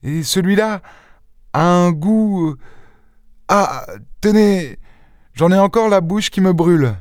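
put-on high young woman's voice, faltering with discomfort; Jeanne, troubled young woman. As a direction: draw out the hesitations, pitched high. (jeune fille troublée, voix aiguë et hésitante)

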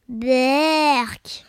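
long drawn-out yuck; revolted, stretched groan of a word; grimacing disgust. A narrator's reading. Pierre hearing the witch's soup ingredients, disgusted. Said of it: "fit Pierre en grimaçant".

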